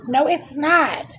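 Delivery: irritated explaining; frustrated outburst. Real, not acted. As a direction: argue it out in exasperation. Frustrated at phone not charging.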